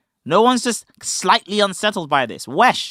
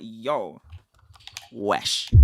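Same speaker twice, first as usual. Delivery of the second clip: low, soft yo wesh, grossed out; disgusted wesh; 11 semitones lower, 10 dB quieter than usual. Shown as a demonstration. Teaching cohost slang, demoing a low disgusted wesh. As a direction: wince at something nasty. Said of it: "If someone does something disgusting".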